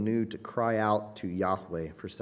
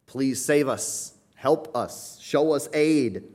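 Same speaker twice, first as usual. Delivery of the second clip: slow, raised pleading prayer; honest plea; 5 semitones higher, 5 dB louder, much slower than usual. Retold or imitated. Prayer to Christ, slow raised pleading. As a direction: drag it out as a heartfelt plea. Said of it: "the most honest prayer or statement".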